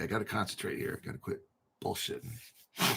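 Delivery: ordinary talk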